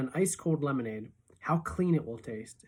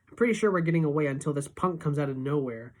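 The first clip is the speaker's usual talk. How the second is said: plain reading voice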